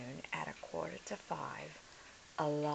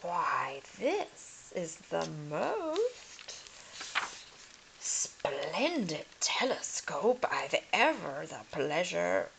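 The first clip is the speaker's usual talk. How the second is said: greedy delight; slower than usual. Acted. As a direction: savour the treasure greedily. Lord finds telescope.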